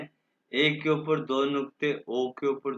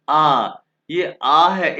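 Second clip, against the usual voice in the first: slow, loud and drawn out, higher; slow loud drill; 3 semitones higher, 11 dB louder, much slower than usual. Shown as a demonstration. Teacher drilling the alphabet: slow loud demo. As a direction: project the letter for the whole class to copy. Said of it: "सबसे पहले है".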